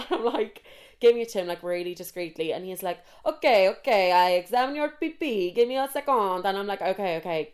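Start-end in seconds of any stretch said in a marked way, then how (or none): 3.3-4.1 loud mock-Italian delivery; Italian accent. Pharmacist taking the sample, in a mock Italian accent. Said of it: "trying to do an Italian accent". 4.1-6.5 high, sing-song mock Italian; botched Italian accent, drifting toward Borat. Italian pharmacist asking for a urine test, in a mock Italian accent. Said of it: "trying to do an Italian accent".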